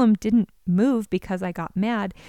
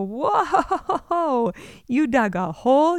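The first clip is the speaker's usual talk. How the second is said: interested, open "whoa", no anger in it; friendly surprised parent; curious calm response; 5 semitones higher, 4 dB louder, much slower than usual. Retold or imitated. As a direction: show curiosity instead of outrage. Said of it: "I have a choice to make".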